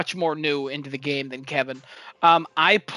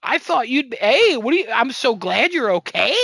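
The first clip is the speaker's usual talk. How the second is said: Asterion; 6 semitones higher than usual. Retold or imitated. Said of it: "I go talk to Asterion".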